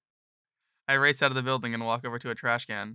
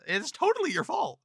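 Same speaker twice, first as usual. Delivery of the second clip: mock scolding. Booth worker scolds Mark.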